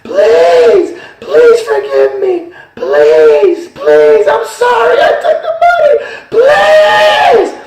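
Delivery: shrieking, very high and very loud begging; howling pleas from the damned; screaming for forgiveness. Retold or imitated. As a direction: scream please as a tormented soul. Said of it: "the people down there were screaming".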